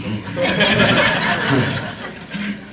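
a long laugh after the punchline; amused laugh. Real, not acted. Preacher laughing after saying the headquarters is in glory. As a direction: laugh at his own joke.

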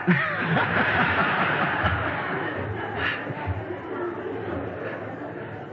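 long quiet laughter; amused laughter; long breathy laugh. Real, not acted. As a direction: laugh it off at length. Preacher laughs at his story.

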